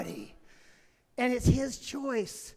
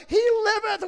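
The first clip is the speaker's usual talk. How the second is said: high, loud triumphant proclamation; joyful cheer; 9 semitones higher, 5 dB louder than usual. Retold or imitated. Drivers cheer that the crashed man is alive, high triumphant cry. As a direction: announce a resurrection. Crowd celebrates his survival.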